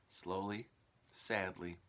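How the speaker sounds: plain reading voice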